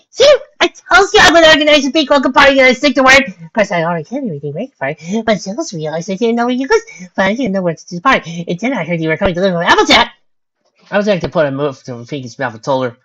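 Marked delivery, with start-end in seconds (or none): 0.1-10.1 high-pitched, gushing party host; excited. A narrator's reading. Pinkie Pie explains her welcome party to Cherry, excited. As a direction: burst out loud, then keep chattering high and excitedly. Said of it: "said excitedly".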